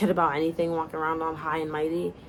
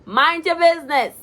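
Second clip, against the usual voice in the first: yelling back; 11 semitones higher, 10 dB louder than usual. Acted. Yelling back through the door.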